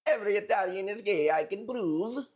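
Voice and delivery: mock Italian. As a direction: read it like a joking Italian. Reading donation as Italian.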